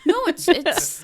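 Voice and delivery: real laughter breaking up the words; laughing. Real, not acted. Cracking up at a friend's joke, real laughing. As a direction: laugh through it, high and loud.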